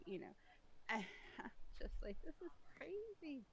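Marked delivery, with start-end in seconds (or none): 2.2-3.4 high quoted thought. Retold or imitated. Exasperated inner thought quoted.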